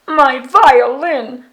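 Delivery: slow, loud anguished monster wail; Yeti crying out.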